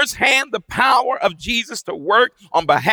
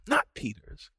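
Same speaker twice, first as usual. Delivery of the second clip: ordinary delivery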